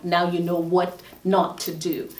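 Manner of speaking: ordinary talk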